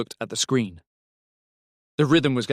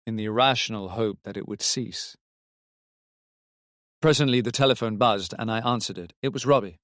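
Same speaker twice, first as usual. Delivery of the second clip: plain reading voice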